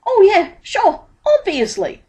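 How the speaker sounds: very high, loud, giddy relief; giddy relief, pitched very high; relieved Dibbler. A narrator's reading. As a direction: gush like a relieved huckster. Dibbler covering up.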